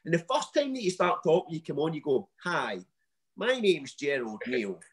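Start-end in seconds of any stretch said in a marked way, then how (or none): 2.4-4.8 mock slow intro in a dodgy American-Scottish accent; lightly put-on American-Scottish accent, cheesy intro; a half-hearted American-Scottish accent. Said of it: "as if some dodgy American stroke Scottish accent".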